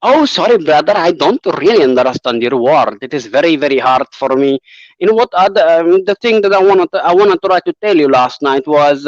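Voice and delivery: put-on Russian accent, rolled r's; heavy mock-Russian accent. Shown as a demonstration. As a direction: speak English like a Russian. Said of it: "try by the Russian".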